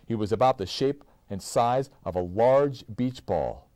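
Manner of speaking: ordinary delivery, nothing marked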